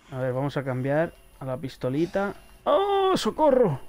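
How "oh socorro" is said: high, sudden cry for help; hammed-up shriek for help; mock scream for help. Sudden attack, calls for help.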